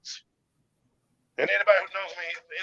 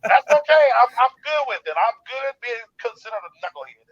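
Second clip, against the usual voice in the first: louder and higher boast; proud declaration; 5 semitones higher, 10 dB louder than usual. Retold or imitated. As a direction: wear the insult proudly. Proudly accepting a nickname.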